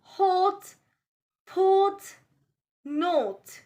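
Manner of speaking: "hot, pot, not" with slightly rounded O; rounded O sound. Shown as a demonstration. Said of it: "Repeat after me".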